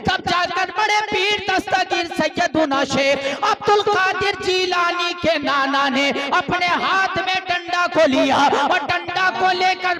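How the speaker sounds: loud, high-pitched sermon storytelling; preaching at full force. Real, not acted. Preacher tells the gathering of a revived son, full-force preaching. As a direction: belt the story out to the crowd.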